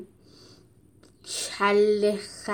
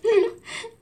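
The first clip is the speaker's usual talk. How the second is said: high sneering chuckle; mocking snigger; smirking laugh; 13 semitones higher than usual. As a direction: laugh smugly at him.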